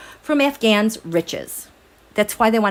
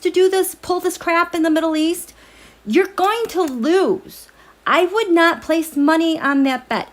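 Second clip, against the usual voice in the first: defiant challenge; 6 semitones higher than usual. Real, not acted. Defiant warning to the Taliban.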